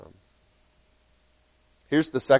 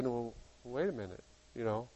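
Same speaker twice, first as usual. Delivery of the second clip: much softer, murmured to yourself; thinking aloud in a near-murmur; inner doubt; 4 semitones lower, 20 dB quieter, faster than usual. Acted. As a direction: think it over under your breath. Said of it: "you start thinking".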